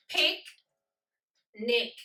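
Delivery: "Picnic" in two separated beats, pic and nic; split into syllables. Shown as a demonstration. Teacher clapping them out, "Picnic" broken into pic and nic. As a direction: say "Picnic" with a long pause between its syllables. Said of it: "So let's clap them out".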